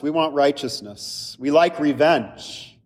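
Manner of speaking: usual speaking voice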